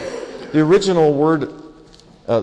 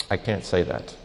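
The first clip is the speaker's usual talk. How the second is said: quiet admission; 7 semitones lower, 8 dB quieter than usual. Retold or imitated. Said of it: "you may have to stop and say".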